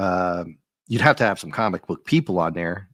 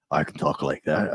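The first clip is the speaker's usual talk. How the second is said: a hint of a lower rocky brute; lightly voiced Thing impression, lower; Ben Grimm voice; 5 semitones lower than usual. Shown as a demonstration. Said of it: "maybe they should have used my voice for Ben Grimm".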